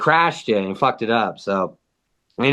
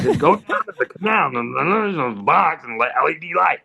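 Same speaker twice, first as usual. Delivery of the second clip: hammed-up garbled mumble; neighbor's weird voice; 3 semitones higher than usual. Neighbor repeating instructions to baffled guests, imitated weird voice. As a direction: imitate the oddball repeating himself, words swallowed. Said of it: "how he sounds and how he says it".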